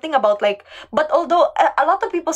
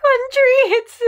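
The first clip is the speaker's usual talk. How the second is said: laughing squeal; 12 semitones higher, 3 dB louder than usual. Real, not acted. Laughing at her own mix-up.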